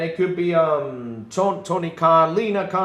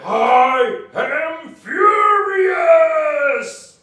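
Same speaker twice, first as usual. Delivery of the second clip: louder, slow, hammed-up heroic proclamation; He-Man parody; 6 semitones higher, 6 dB louder, slower than usual. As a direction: bellow it like a cartoon hero. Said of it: "He Man reference".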